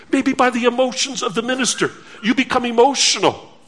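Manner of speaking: normal talking voice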